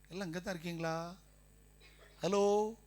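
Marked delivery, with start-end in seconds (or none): none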